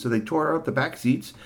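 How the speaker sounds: usual speaking voice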